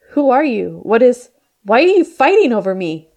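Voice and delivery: high, loud frightened outburst; panicked. Acted. Mina terrified of the fighting strangers, panicked. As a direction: demand answers in rising panic.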